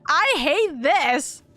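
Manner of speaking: high, slow whimpering lament; near tears. Real, not acted. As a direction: moan it through tears. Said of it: "I'm already starting to cry".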